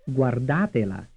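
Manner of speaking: "Guardatela" with the beat on DA; second-syllable stress. Shown as a demonstration. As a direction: lean on "da" in "Guardatela", then fall away. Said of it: "stressed on the second syllable".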